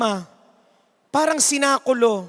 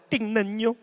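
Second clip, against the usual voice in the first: hushed announcer; 2 semitones lower, 5 dB quieter than usual. Acted. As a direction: pull the crowd in quietly. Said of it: "Sabi nung announcer".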